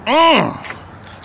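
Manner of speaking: high appreciative mm; admiring moan. Acted. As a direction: savor the car with a high mm. Admiring the Cadillac.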